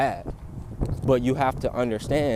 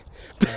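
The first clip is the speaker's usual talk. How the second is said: short laugh. Real, not acted. Laughs mid punchline.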